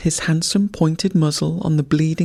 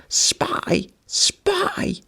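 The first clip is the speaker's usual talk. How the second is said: very high, airy cawed "Spy! Spy!"; crows cawing. A narrator's reading. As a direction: caw it out like crows. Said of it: "the crows cawed".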